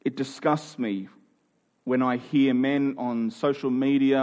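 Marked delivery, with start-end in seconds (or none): none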